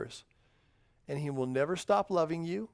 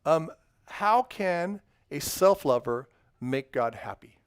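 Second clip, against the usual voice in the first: usual speaking voice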